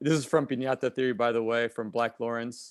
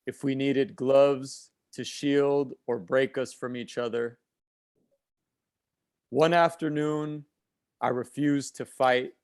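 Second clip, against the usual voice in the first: slow, measured poem recitation with long pauses; spoken-word poem, unhurried and deliberate; poetry reading; slower than usual. Acted. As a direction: recite it line by line, letting each phrase hang.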